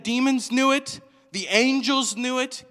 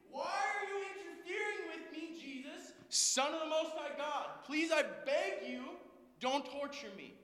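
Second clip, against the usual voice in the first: high, hushed pretend scream; strangled far-off wail, begging for mercy; mock screamed plea; 7 semitones higher, 13 dB quieter than usual. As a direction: scream it under your breath, very high and soft. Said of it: "He screamed".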